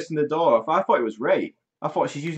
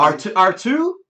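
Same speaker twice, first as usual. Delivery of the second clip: higher, louder Luke calling the droid; old Luke voice; 8 semitones higher, 8 dB louder than usual. Retold or imitated. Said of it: "He went".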